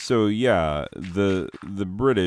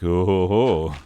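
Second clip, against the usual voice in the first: loud, put-on "ho ho ho"; hammed-up knowing chuckle; mock scoffing laugh; 6 dB louder than usual. Teacher scoffs at students' objection.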